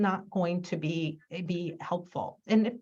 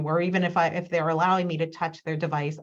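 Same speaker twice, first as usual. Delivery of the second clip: ordinary talk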